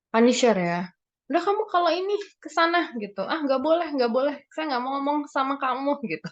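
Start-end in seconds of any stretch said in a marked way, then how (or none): 1.3-2.9 lightly voiced punisher, higher and quick; a hint of a punisher sending someone away, voice raised in pitch. Punisher shooing a child away, mock scolding. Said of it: "Itu punisher ya". 3.2-6.0 lightly voiced punisher refusing, pitched higher; a hint of a huffy punisher. Punisher refusing to talk to a child, mock rejection. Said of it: "Itu punisher ya".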